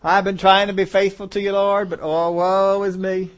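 loud slow wailing lament; hammed-up cry of self-pity, dragged out; mock woe is me. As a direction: Moses pretending to despair before the angry people, loud and slow. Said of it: "he could say".

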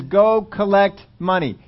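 loud, slow, drawn-out royal order; the king's command. Retold or imitated. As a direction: bark it slowly like a tyrant king. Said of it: "and he says".

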